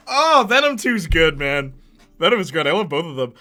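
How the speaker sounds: normal talking voice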